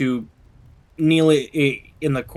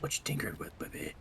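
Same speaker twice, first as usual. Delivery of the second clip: whispering; 7 semitones lower, 16 dB quieter, faster than usual. Acted. Teasing whisper to another player.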